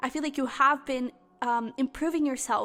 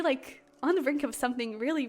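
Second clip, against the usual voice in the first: ordinary talk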